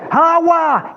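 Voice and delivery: high, drawn-out cry; shouting. Retold or imitated. Man commanding the rain to come, shouted. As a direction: call the rain down at full voice. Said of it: "empecé a gritar".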